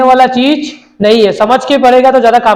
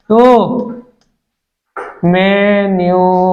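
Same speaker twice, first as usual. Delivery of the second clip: writing-along dictation; 3 semitones lower than usual. Real, not acted. Writing the word while saying it.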